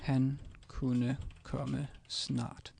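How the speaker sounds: very slow, every word set apart; slow careful Danish. Shown as a demonstration. Teacher modelling the sentence for a learner, slowly. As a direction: spell out "Han kunne komme snart" slowly for a learner. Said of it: "And this would be".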